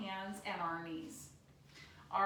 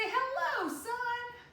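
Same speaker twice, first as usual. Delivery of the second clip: much higher, bright sung hello; high sing-song greeting; 11 semitones higher, much slower than usual. Shown as a demonstration. Kids yoga greeting the sun.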